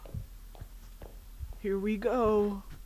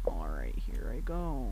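whispering; 11 semitones lower, 4 dB quieter than usual. Real, not acted. Steeling herself before going on.

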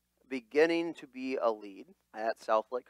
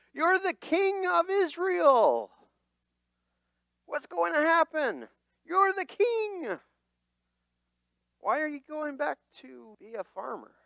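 incredulous crowd; 13 semitones higher, 4 dB louder than usual. Acted. People urging Saul, high and pleading. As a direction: beg the king not to go home.

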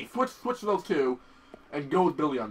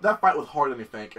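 ordinary talk, nothing marked

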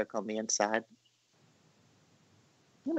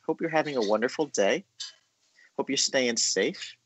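normal talking voice

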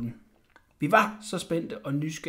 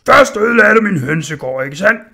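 loud, higher angry blacksmith yelling; angry shout; 4 semitones higher, 14 dB louder than usual. Acted. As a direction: bellow it at the boy like a furious smith. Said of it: "råbte smeden vredt".

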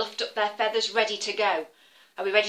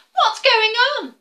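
high, loud demanding call; the eagle barking a question; show-off eagle, loud and high; eagle's demand; 13 semitones higher, 11 dB louder than usual. Acted. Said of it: "he said".

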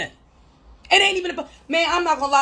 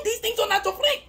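laughing; 7 semitones higher, 4 dB quieter than usual. Real, not acted. Winding down into a laugh.

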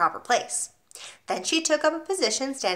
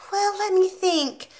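put-on 1 cheeky small boy; 10 semitones higher, slower than usual.

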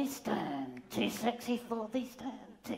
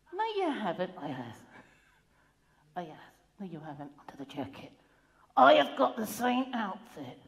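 ordinary talk, nothing marked

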